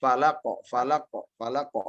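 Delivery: "Falaqo" with the q bounced straight off, no pause; qalqalah bounce. Shown as a demonstration. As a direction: snap the q of "Falaqo" immediately. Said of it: "Seperti itu".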